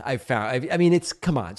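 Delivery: ordinary delivery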